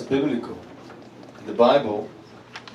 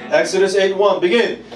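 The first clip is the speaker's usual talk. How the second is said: unison scripture reading; 6 semitones higher, 5 dB louder than usual. Acted.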